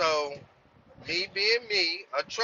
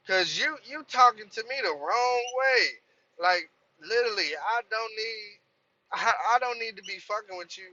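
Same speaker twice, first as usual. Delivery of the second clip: retold telling off; 6 semitones higher than usual. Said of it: "And I was just like".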